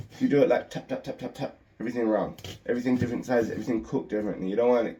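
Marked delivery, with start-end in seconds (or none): none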